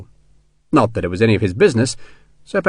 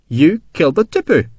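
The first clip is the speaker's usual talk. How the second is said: higher-pitched, half-accusing realisation; almost accusing; 7 semitones higher than usual. A narrator's reading. Colonel confronting sergeant over the killing, almost accusing. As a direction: nearly accuse him. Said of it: "It was almost an accusation".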